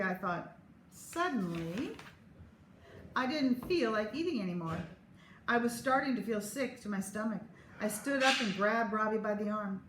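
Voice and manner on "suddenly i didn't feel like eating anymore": ordinary delivery, nothing marked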